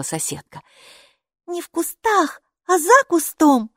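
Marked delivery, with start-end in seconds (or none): 1.5-3.7 put-on high girl voice, за stressed; correcting. A narrator's reading. Girl correcting the neighbour, stressing за. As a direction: correct her: not в кустах but за кустом. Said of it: "поправила Майки".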